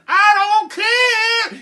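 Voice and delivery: very high, loud, drawn-out yell; exasperated wail. Acted. Mother answering Jack at the door, high wailing yell. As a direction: wail it back, stretching the last word.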